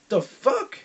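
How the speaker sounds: a stunned coach blurting it out; shocked coach. Retold or imitated. Coach seeing the student flatten a boy, startled disbelief.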